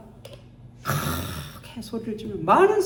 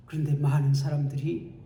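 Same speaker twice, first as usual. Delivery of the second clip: ordinary talk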